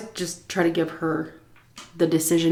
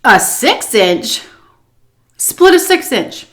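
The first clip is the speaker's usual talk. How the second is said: slow disbelief, then a loud high repeat of "Split a six inch"; can't believe she said that; incredulous; 3 semitones higher, 5 dB louder than usual. Real, not acted. Repeating the boss's odd offer back to the audience, loud and incredulous.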